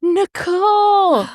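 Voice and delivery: drawn-out, high call of a name; mock emotional cry. Parents spotting their missing daughter in the shadows, dramatic cry. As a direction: cry out to a lost child, stretched long. Voices them finding the lost child.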